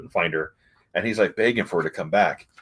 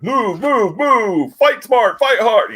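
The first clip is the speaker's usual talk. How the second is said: mock battle commands, high and loud; Red Hulk; 13 semitones higher, 5 dB louder, much slower than usual. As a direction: shout orders in a hammed-up high yell. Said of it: "Red Hulk's like".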